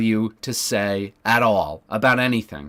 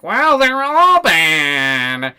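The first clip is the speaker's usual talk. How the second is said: high, very slow and louder, droning; higher, louder mock-solemn drawl; mock Wick voice; 7 semitones higher, 8 dB louder, much slower than usual. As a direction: stretch it out like a dim sage. Mocking Wick's answer.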